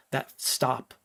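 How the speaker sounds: normal talking voice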